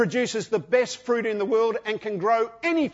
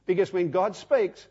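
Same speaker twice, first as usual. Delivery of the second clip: ordinary talk, nothing marked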